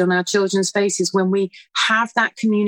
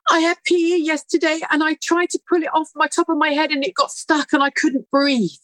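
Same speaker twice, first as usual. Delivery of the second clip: put-on higher, childlike voice, lightly done; a mother voicing her young son's account, higher; little boy's voice; 7 semitones higher than usual. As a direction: voice the small son explaining, lightly. Son explains the shirt trauma.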